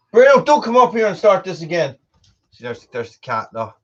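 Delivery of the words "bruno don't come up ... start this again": scolding the dog. Real, not acted.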